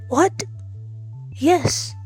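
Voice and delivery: ordinary talk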